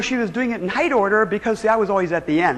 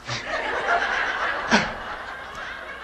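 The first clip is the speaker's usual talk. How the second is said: quiet unvoiced laugh; soft wheezing chuckle; soft breathy laugh; 4 semitones lower, 7 dB quieter than usual. Real, not acted. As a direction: let a hushed laugh escape. Laughing at Earl's reply.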